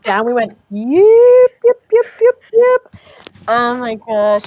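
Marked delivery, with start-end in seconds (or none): 0.7-3.0 high, loud yips instead of a scream; yipping down the drop. Retold or imitated. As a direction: yelp high and loud going down the ride. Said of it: "instead of screaming".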